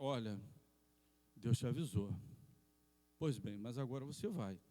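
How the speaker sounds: low, measured angel voice; angel's warning. Acted. Angel with a sword addressing the traveler, low and measured. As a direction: warn him low and deliberately.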